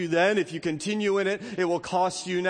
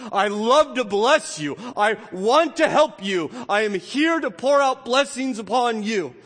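voice of God; 5 semitones higher, 5 dB louder than usual. Acted. Pastor voicing God's love to his people, earnest. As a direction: pour the promise out to them. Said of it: "God says".

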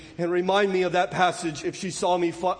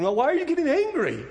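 challenging question; 7 semitones higher, faster than usual. Acted.

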